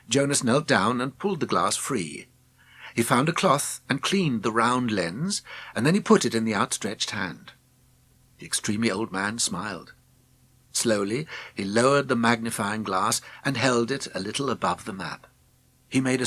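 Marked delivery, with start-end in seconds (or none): none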